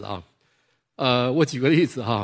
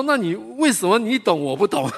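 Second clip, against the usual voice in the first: angry retort; 9 semitones higher, 4 dB louder than usual. Retold or imitated. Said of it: "我们两个都很生气".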